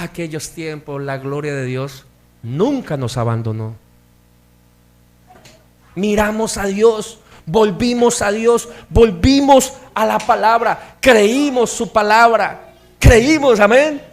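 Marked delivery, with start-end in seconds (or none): 5.9-14.0 loud, high preaching cadence building up; fervent preaching. Acted. Pastor exhorts the congregation to believe the word, loud and fervent. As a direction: preach it, rising to a shout.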